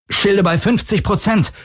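high, strained call over the din; urgent high-pitched report over impacts; shouting over noise. A narrator's reading. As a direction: grind it out over the noise of battle. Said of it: "knirschte er über den Lärm".

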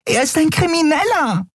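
very high, indignant squeal; shrill and outraged. A narrator's reading. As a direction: squeak the accusation out. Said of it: "wiederholte die Jadi schrill".

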